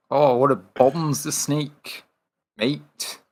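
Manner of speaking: slow, hammed-up Australian croc hunter; fake Australian accent. Mocking Steve Irwin's Australian accent for a friend. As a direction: drawl it like a mock Aussie showman. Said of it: "fake Australian accent".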